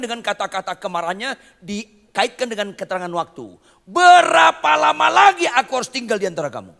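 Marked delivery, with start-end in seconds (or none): 3.9-6.7 high, loud furious cry; angry outburst. Acted. Preacher voicing Jesus angry at faithless people, high furious shout. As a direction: shout it out in exasperation. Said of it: "Itu marah besar".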